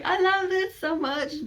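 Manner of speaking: gushing. Retold or imitated. Reader overwhelmed by a sad story, gushing. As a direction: swoon over the book.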